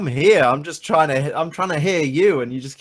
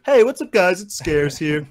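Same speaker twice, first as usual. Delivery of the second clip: YouTuber intro; 3 semitones higher than usual. Retold or imitated. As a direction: chirp it like a channel intro. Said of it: "for not starting videos with".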